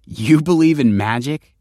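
barely concealed judgment; failed non-judgmental tone. A narrator's reading. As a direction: try to hide the disbelief and fail. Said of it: "trying to sound non-judgmental, and failing".

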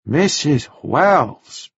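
very slow, lightly voiced foreign man; careful repeat. A narrator's reading. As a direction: repeat the name slowly, learning it. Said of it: "he repeated".

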